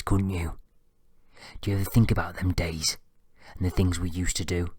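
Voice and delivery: a hint of a lewd old man; lightly voiced creepy father; lightly voiced leering dad. A narrator's reading. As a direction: leer at the son.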